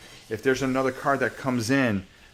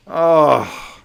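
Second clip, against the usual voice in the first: surprised; 4 semitones higher, 13 dB louder than usual. Real, not acted. Dice roll goes badly.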